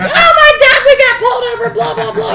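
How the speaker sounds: very high, loud panicked mock yell; yelling sister. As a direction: imitate a hysterical kid sister shrieking. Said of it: "punching me and yelling at me".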